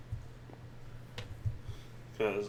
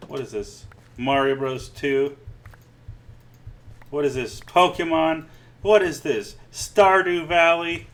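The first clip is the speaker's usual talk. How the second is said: each question higher and louder, ending slow and shrill; mounting mock outrage; 5 semitones higher, 8 dB louder, slower than usual. Streamer ranting at viewers about copycat games, escalating mock disbelief. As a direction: build from puzzled to incredulous yelling.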